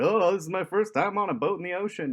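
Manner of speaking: high, loud mock-anxious newbie; mock nervous beginner. Mocking own beginner worries.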